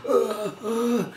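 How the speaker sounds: high, breathy mock death gasps; imitating an overacted dying grunt; dying groan. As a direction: ham up a movie death rattle. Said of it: "when people do a dying scene and he sort of go".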